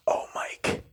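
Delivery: "Oh, Mike" as a voiceless whisper, very soft; under her voice. Retold or imitated. Woman at the nurses' counter whispering his name under her voice. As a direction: breathe "Oh, Mike" with no voice at all. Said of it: "she says it under her voice".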